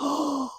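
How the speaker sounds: imitated sharp intake of breath; gasp, then a high little "oh"; shocked gasp. Mother re-enacts hearing her daughter speak, startled gasp. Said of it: "And I went".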